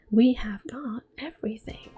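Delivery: normal talking voice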